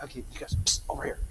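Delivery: hushed psst, calling attention softly; whispering. Real, not acted. Calling kids back with psst.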